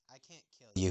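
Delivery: broken, very soft sob; sobbing softly. Acted. Huntsman sobs to the princess he cannot kill. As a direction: choke it out through tears. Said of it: "he sobbed".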